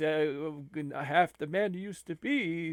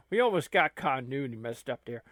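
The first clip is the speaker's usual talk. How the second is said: usual speaking voice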